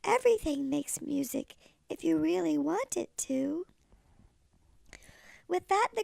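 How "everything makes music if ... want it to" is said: high, gentle little cricket voice; sweet old lady cricket; kindly cricket. Acted. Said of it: "coughed a cricket".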